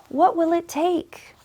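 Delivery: ordinary delivery, nothing marked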